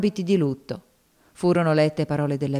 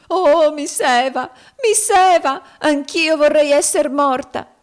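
much higher, louder, slow wailing cry; wild grief; 11 semitones higher, 8 dB louder, slower than usual. A narrator's reading. Topsy sobbing over dead Eva, wild wailing. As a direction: wail out her despair. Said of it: "qualche cosa di selvaggio e di forsennato".

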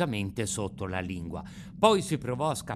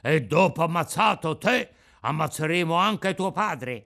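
menacing threat; 3 semitones higher, 5 dB louder than usual. Acted. Bandits threaten his father.

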